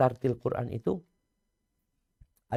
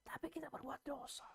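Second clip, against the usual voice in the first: breathy confession, no voice; whisper; 19 dB quieter than usual. Acted. Preacher admits the congregation's sin, whispered. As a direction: let it out as a guilty whisper.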